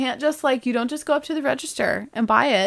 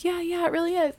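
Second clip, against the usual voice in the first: put-on higher, softer girlish yes; eager girls; 9 semitones higher, 4 dB quieter than usual. Girls answering their aunt, higher and eager. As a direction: nod along eagerly. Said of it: "everyone's like".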